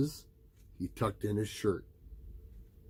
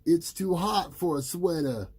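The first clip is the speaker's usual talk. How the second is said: put-on boy's protest; 8 semitones higher than usual. A narrator's reading. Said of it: "said Peter".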